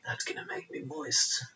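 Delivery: breathy whispered aside. Real, not acted. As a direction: whisper it close to the mic.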